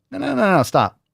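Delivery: high rapid no; excited no. Acted. Host excitedly shutting down a guess. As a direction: cut them off eagerly.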